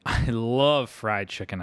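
slow, drawn-out hopeful gush; sudden hope. A narrator's reading. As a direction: stretch it out with sudden longing. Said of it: "with a sudden hope".

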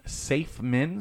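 ordinary talk, nothing marked

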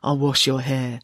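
put-on surly young woman, understated; grudging, sullen offer. A narrator's reading. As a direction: say it like a chore you resent. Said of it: "said the surly young woman".

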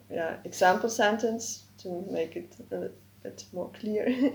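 usual speaking voice

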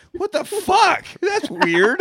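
high-pitched incredulous outburst; baffled disbelief. Retold or imitated. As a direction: squeal it in mock bewilderment. Reenacts baffled reaction to misheard title.

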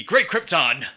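put-on loud, punchy old-time announcer; 1950s sports announcer. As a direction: boom it like a fifties sportscaster. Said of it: "Like nineteen fifties sports announcer".

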